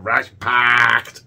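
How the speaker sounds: "ration-packed" drawn out slowly in a mock Scottish accent; slow hammy Scottish "ration-packed". Shown as a demonstration. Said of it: "trying to do ration-packed in a Scottish accent".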